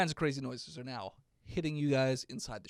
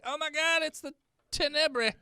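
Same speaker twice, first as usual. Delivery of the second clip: panicked; 12 semitones higher, 4 dB louder than usual. Acted. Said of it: "distress signal".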